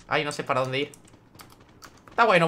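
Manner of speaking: ordinary delivery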